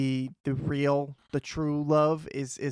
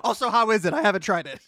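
mock Merlin; 5 semitones higher, 4 dB louder than usual. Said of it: "He's like".

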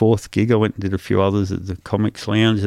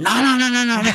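high, rushed string of no's; emphatic refusal. Retold or imitated. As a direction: shut the idea down fast, pitch jumping up. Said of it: "I went".

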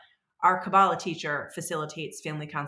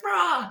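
high-pitched "rah" of road rage; mock angry roar; 11 semitones higher, 4 dB louder than usual. Driver cut off in traffic, exaggerated angry roar. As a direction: let out a playful raging yell. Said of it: "Someone cuts me off at traffic".

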